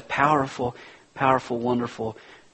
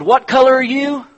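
high, loud, insistent question; pressing demand; 13 semitones higher, 10 dB louder than usual. Retold or imitated. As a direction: push them for the right answer. Driver asks again, pressing.

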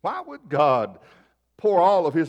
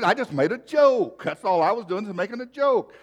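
re-enacted high-pitched self-defence; defensive protest; 7 semitones higher than usual. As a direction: plead innocence, pitch climbing. Defends himself to scolding wife.

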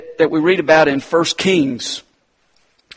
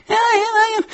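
put-on childish voice. Small kids answering the teacher.

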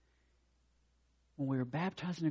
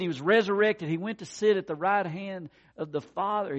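raised, higher preaching voice; 7 semitones higher, 8 dB louder than usual. Real, not acted. Preacher exalts the risen Christ to congregation, proclaiming.

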